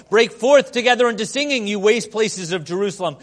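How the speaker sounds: loud, high ringing proclamation; scripture read at full preaching force; proclaimed scripture. Acted. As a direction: cry it out like a prophet. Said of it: "He cries out to us".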